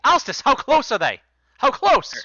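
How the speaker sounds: high, loud call across the room; yelling over. Acted. Blanchett yelling to Alstus across the tunnel, loud call. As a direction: shout the question to a distant lookout. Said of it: "yells over to you".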